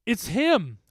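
high, loud cry of recognition; shouted alarm. Acted. Narrator stumbling back from the specter, shouting. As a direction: shout a warning to the group. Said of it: "I shouted".

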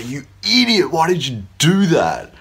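lightly acted insult at a friend who made a mistake; mock berating. Imagined speaker scolding a friend over a mistake, mock angry.

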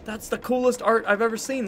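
high, slow gasp of delight; drawn-out amazement; excited. Real, not acted. Excited about coin purse.

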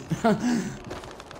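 short airy chuckle; soft laugh. Real, not acted. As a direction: let a quiet laugh slip out. Laughs after mock awe.

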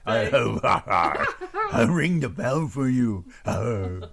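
low groaning servant to his master; Hunchback of Notre Dame. Acted. Host teasing co-host about a hump, mock Hunchback voice. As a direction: moan it low, like a devoted bell-ringer. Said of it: "the Hunchback of Notre Dame".

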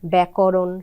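ব্যাকরণ said as "bækoron", with an open e sound; pronunciation demo. Shown as a demonstration. Reciter gives ব্যাকরণ as a model word. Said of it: "তখন হচ্ছে ব্যাং".

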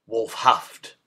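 ordinary delivery, nothing marked

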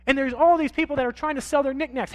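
normal talking voice

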